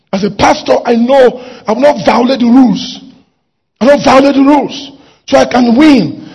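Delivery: loud, emphatic declamation; forceful preaching. Real, not acted. Preacher vowing not to violate the rules, forceful declamation. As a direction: drive each phrase home loudly.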